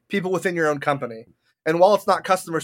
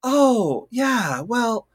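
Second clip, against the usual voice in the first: phone voice; 6 semitones higher, much slower than usual. Retold or imitated. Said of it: "that's the whole voice that I use too".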